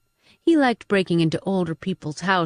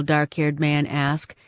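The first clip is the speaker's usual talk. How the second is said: ordinary delivery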